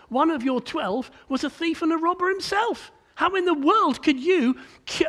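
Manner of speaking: acted outrage at a hypocrite; indignant accusation. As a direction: throw the hypocrisy back at him. Imagined accusers challenge Jesus for hypocrisy.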